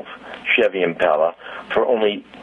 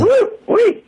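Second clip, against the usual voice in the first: excited whoop; 5 dB louder than usual. Real, not acted. Excited about homemade cookies.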